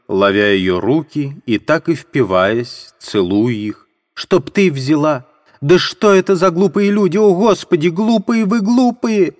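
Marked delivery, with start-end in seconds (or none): 4.1-9.3 very high, furious cry; shouting at everyone. Acted. Katerina berating the onlookers, shrill furious cry. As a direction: berate the crowd. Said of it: "кричала она, обращаясь ко всем".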